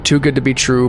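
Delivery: plain reading voice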